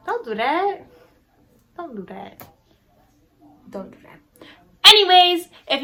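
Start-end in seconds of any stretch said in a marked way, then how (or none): 0.1-0.9 high-pitched playful telling-off; playful scolding. Real, not acted. Vlogger telling off unsubscribed viewers, playfully scolding, high. 1.8-4.5 quiet repeat fading into a whisper; hushed scolding. Real, not acted. Vlogger warning unsubscribed viewers, hushed and fading to whisper.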